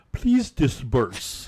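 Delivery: slow, drawn-out haughty order. Pretend. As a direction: stretch it out like a snob. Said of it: "add a rich attitude".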